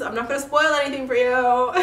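giggling through the words; laughing. Real, not acted. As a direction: laugh as you tease. Laughs at own teasing.

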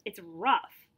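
ordinary delivery

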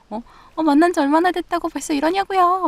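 high, loud flustered protest; mock flustered. Partner protesting an early hug, imitated high and flustered. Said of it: "막 긴장하고".

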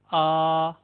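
long open आ sound; Turkish letter A. Shown as a demonstration. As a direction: say आ clearly and open. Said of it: "first letter है हमारे पास". (Turkish "आ" साफ़ और खुला बोलें)

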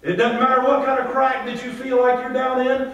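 full-voiced pulpit swell; rising preaching. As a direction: preach it up with growing fire. Preaching builds to full force.

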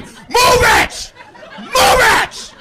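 very high, loud mock scream; screaming driver. Irate driver screaming at the car ahead, high loud scream. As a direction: imitate a furious man screaming an order. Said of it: "starts screaming at me".

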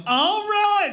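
high, drawn-out celebratory whoop; re-enacted cheer. Father retelling his reaction to triplets news, high excited cheer.

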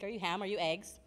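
usual speaking voice

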